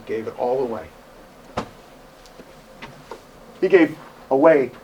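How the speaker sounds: hushed awe. Acted. Preacher telling the congregation how Studd gave everything away, hushed and reverent. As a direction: drop to a reverent hush.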